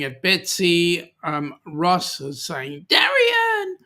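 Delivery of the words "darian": very high, drawn-out greeting call; playful high-pitched hail to a friend; calling out a name. Acted. Reading out a friend's shouted greeting.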